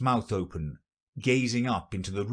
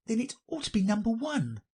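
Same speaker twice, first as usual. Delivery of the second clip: put-on very high girlish comeback; high, light girl voice; Alice retorting; 13 semitones higher than usual. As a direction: reason back as little Alice. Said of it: "said Alice".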